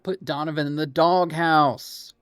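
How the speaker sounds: loud playful call-out, pitch up; shouting. Real, not acted. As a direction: yell it across the room, teasing. Calling out a friend on stream.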